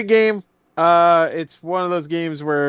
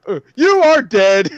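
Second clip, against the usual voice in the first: slam poet quote; 5 semitones higher, 5 dB louder, slower than usual. Retold or imitated. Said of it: "He's just like".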